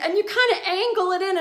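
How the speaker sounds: high-pitched instructing-mom impression; mock helpful instruction, high voice; Charlotte.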